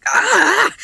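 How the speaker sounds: short high, loud scream; startled scream. A narrator's reading. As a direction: scream in sudden fright. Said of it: "he screamed".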